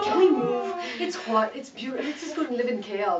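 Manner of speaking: high, loud, laughing plea, then settling; excited pleading. Retold or imitated. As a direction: beg the family like an eager kid. Acted plea to her family.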